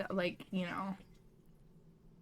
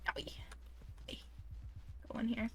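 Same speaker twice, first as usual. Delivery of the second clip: ordinary delivery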